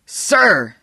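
high, loud scream of command. A narrator's reading. Stilson correcting the cowed kid, screamed. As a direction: scream the word as a furious correction. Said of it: "Stilson screamed at him".

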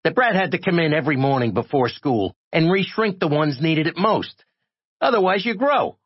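high-pitched griping voice; high, put-upon complaint; complaining little character. A narrator's reading. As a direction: grumble in a small, high voice. Shrunken character complains in own voice.

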